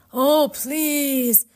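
high, loud ghostly plea; pleading ghosts. A narrator's reading. Ghosts clutch at Orpheus for help, pleading. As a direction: wail it imploringly.

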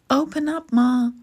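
high little voice calling to be let in; urgent shout. A narrator's reading. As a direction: shout up at a shut door. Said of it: "he shouted".

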